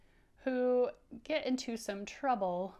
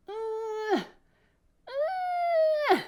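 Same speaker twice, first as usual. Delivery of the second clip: very high, loud effortful "Uh, uh"; straining grunts; 8 dB louder than usual. Acted. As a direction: strain as if pulling hard. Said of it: "he's going".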